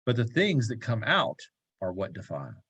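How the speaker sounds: measured, formal reading of a gospel passage; lectern-style Bible reading; scripture reading. Acted. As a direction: read the Bible text aloud to the group. Reads a gospel passage aloud.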